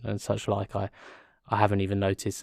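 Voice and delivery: ordinary delivery, nothing marked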